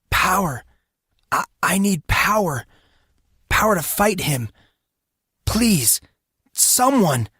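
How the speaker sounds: high, pleading cry for power; desperate inner voice screaming for help. Acted. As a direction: beg at the top of the voice. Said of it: "screamed the voice in his head".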